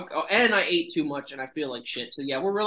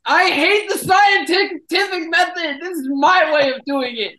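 shouting; 11 semitones higher, 8 dB louder, slower than usual. Pretend. Mock outrage at scientific method.

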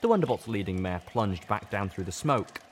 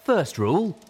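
much higher, rising disbelief; incredulous question; 10 semitones higher, 8 dB louder than usual. A narrator's reading. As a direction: echo it back in disbelief. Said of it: "A Wonderbolt buck asked".

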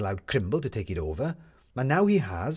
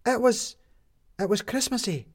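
put-on high child's voice; a small kid's eager stammer, high; Midge; 12 semitones higher than usual. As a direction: Midge stumbling over the word for Maddie, high. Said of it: "said Midge suddenly".

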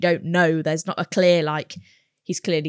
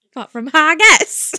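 a Scottish try that comes out Welsh, louder and higher; failed Scottish accent; 10 semitones higher, 10 dB louder than usual. Shown as a demonstration. Asked to do the accent, a Scottish attempt that sounds Welsh. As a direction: aim for Scottish, land in Welsh. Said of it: "That, that was Welsh".

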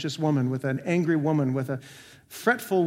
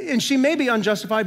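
usual speaking voice

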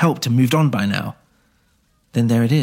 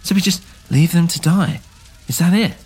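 put-on lightly higher, indignant young healer; Rose, shocked Naya would leave them, lightly higher and indignant; a hint of shocked Rose; 7 semitones higher, 3 dB louder than usual. Rose shocked at leaving villagers.

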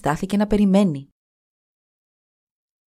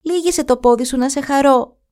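calling out; 7 semitones higher than usual. Acted. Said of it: "της φώναξε το αγόρι".

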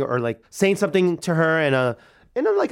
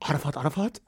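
quiet, rising question; crying halted by bafflement, imitated; acted disbelief; 6 dB quieter, faster than usual. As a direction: repeat the name softly as a puzzled question. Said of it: "enough to make me stop crying".

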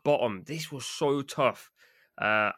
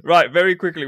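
loud; 7 semitones higher, 12 dB louder than usual. Real, not acted. Rushing to next segment.

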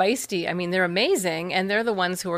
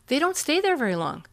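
ordinary talk, nothing marked

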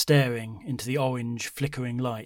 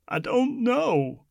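put-on slow tearful old woman; 13 semitones higher, 4 dB louder, much slower than usual. A narrator's reading. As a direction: hold back the tears. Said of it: "to hide her tears".

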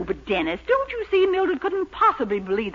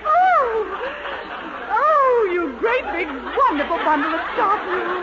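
thrilled squeal; 6 semitones higher, slower than usual. Acted. Old spinster gushing at Dennis on her doorstep, thrilled squeal.

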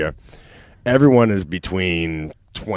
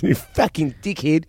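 put-on British accent; 9 semitones higher than usual. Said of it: "that's totally British".

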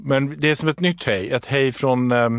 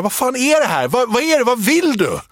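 panicked Erik; 9 semitones higher than usual. Retold or imitated. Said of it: "Erik får panik".

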